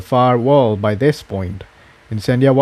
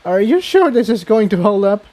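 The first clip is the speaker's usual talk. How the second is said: nervous laugh; 9 semitones higher than usual. A narrator's reading. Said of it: "with a nervous laugh".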